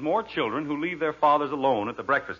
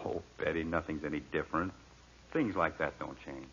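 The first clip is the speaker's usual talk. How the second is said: gentle fatherly; 8 semitones lower, 8 dB quieter than usual. Acted. Father softens to daughter.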